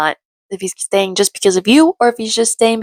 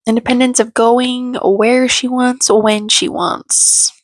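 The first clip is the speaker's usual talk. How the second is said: slow; 4 semitones higher, slower than usual. Real, not acted. Drawn-out emphasis.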